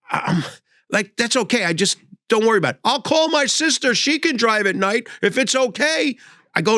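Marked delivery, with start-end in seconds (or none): 1.2-6.2 put-on eager old man. Elderly driver offering sister.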